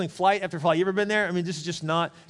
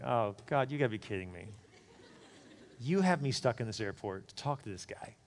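low, much softer groan to God; exasperated prayer; 6 semitones lower, 8 dB quieter than usual. Retold or imitated. Stranded pastor complains quietly to God, low and soft. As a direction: mutter to God in disbelief.

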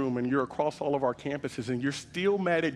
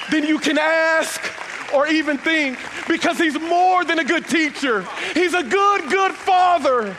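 full-force preaching; 12 semitones higher, 10 dB louder than usual. Real, not acted. Pastor lifts the congregation at the sermon peak, full-force preaching. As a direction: build to a shouting climax.

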